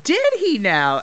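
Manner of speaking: slow, high teasing did he now; mock surprise. Interviewer teases the wrestler, slow mock surprise. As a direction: raise the eyebrows with your voice.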